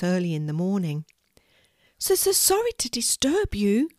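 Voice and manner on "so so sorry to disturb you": high, stammering little mouse voice; a tiny mouse stammering nervously; squeaky stammered apology. Acted. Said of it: "stammered the mouse".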